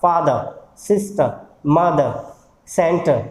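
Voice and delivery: फादर, सिस्टर, मदर, सेंटर each ending on a schwa; final R becomes "ah". Shown as a demonstration. Teacher repeats examples for learners, final R silent. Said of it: "आ बच जाएगा हमारे पास".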